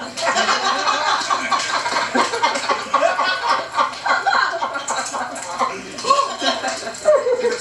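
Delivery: long, high-pitched laughing fit; real laughter. Real, not acted. Room reacting to the Tiger Woods punchline, real laughter. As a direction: laugh helplessly at the joke.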